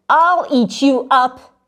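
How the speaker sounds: loud, slow, hammed-up threat; mock roar. Max yelling at his mother, a playful loud threat. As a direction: growl it like a wild boy playing monster. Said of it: "and Max said".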